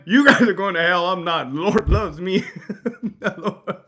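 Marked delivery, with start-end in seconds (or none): 0.1-1.1 loud mock rebuke. Real, not acted.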